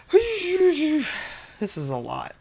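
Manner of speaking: high, slow, drawn-out amazement; overwhelmed. Real, not acted. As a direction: stretch it out, swamped by cards. Overwhelmed by many cards.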